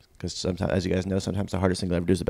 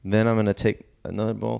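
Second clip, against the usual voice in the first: normal talking voice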